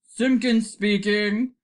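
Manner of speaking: slow, self-important phone answer; very important telephone voice. A narrator's reading. Father picking up the telephone, very important voice. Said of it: "in his very important telephony voice".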